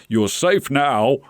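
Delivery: slow, gentle reassurance; reassuring. Acted. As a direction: soothe the frightened bird slowly. Mr Gonzalez comforts parrot.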